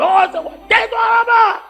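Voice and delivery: angry shout. Acted. Tired husband yells at his wife to shut up. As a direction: bark at her to shut up.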